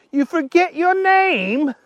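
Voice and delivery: very high, disbelieving question; incredulous. A narrator's reading. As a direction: squeak it out in disbelief. Archie in disbelief.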